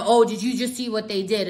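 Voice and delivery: ordinary talk, nothing marked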